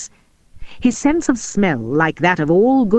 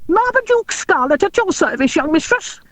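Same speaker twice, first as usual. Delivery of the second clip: high, squeaky little dwarf voice; crisp squeaky voice; 11 semitones higher than usual. A narrator's reading. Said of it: "he said, in a crisp, squeaky voice".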